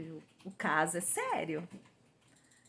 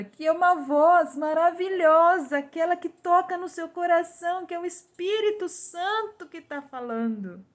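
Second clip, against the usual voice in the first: fervent rise; 8 semitones higher, 8 dB louder than usual. Real, not acted. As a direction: lift the voice in awe of the wonderful voice. Describing the seductive voice. (alto e forte, com fervor)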